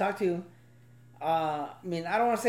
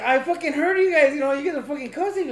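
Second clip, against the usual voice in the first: higher, louder scolding, fast; mock angry dad; 6 semitones higher, 4 dB louder than usual. Dad retells confronting his swearing kids in a louder mock scold. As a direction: call the kids out, half-mocking. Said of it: "I'm like".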